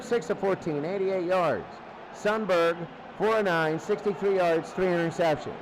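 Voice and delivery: ordinary talk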